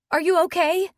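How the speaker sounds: anxious concern. Acted. Anxious apology to upset mother.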